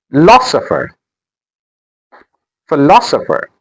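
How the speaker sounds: "Philosopher" with LOS made prominent; stress on second syllable. Shown as a demonstration. Teacher modelling word stress, "Philosopher" stressed phi-LOS-opher. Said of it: "The stress is on L".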